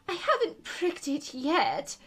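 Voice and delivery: high, loud complaint between screams; distressed Queen. A narrator's reading. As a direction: wail it as the agitated Queen. Queen wailing between her screams.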